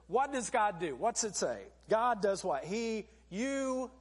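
ordinary talk, nothing marked

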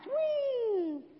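high, loud squeal of fun; gleeful whee. Acted. Cub running downhill, high gleeful squeal. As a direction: sail down the slope squealing.

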